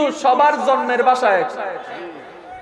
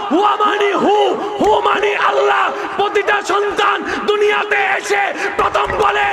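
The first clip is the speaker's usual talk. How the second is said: fiery preaching; 6 semitones higher than usual. Acted. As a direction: preach it with full fire to the crowd. Preaching at full force. (উচ্চস্বরে জোরালো ওয়াজ)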